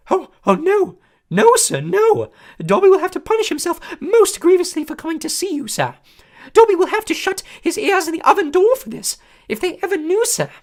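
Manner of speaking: high, loud panicky elf voice; terrified of his masters; frightened house elf. A narrator's reading. House elf dreading punishment, high frightened squeak.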